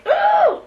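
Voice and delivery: retold ooh, swooping very high; mock ooh. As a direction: squeal a delighted ooh up high. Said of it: "I did find myself going".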